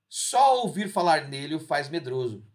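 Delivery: normal talking voice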